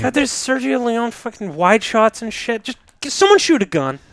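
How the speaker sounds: high-pitched, hammed-up impatience; mock exasperation. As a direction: plead in exaggerated frustration for some action. Acted impatient viewer of a Western.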